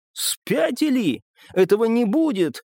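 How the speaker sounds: high-pitched indignant refusal; angry outburst. A narrator's reading. Bessik recoils from the narrator's offer, angrily indignant. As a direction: refuse outright, outraged and high. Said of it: "потом буквально гневом".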